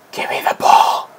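voiceless, strained demand; frustrated demand. Real, not acted. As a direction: force the words out without voice. Demanding the ball again.

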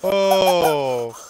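slow stretched-out amazement; drawn-out disbelief. Real, not acted. Stunned by the play.

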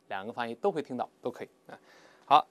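normal talking voice

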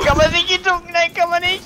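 high-pitched excited yell; excited shout. Real, not acted. Excited shouting while playing.

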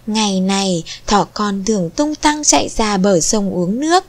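ordinary delivery, nothing marked

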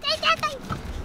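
high sing-song coaxing; cooing pet talk. Real, not acted. Cooing to dog for a greeting.

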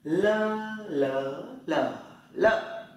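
"Lơ" high level, rising, dipping, falling; le tone drill. Shown as a demonstration. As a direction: step each long "lơ" through the tones. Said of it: "Thử này". (đọc "lơ" qua bốn thanh điệu)

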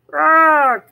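high, loud call across the river; shouted. Acted. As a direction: call out to a far-off friend. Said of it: "he shouted".

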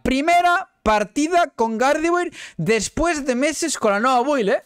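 high, slow drawn-out brag; proud boast. Real, not acted. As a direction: stretch it out proudly. Boasting, repeated then plainer. (fanfarroneo lento y agudo)

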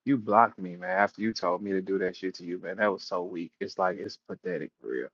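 normal talking voice

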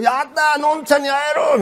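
put-on high, happy cry of a little boy; lightly voiced kid shouting yay; excited child. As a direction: cheer like a five-year-old who gets his wish. Excited five-year-old quoted.

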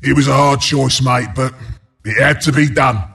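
low, rueful geezer; mock regretful. Announcer excuses a denied tower to mate, mock regretful.

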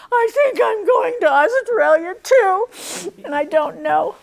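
high, loud, tearful words breaking into a cry. Real, not acted. Telling the nun through real tears, high crying voice. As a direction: let the tears take over the words. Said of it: "I started crying".

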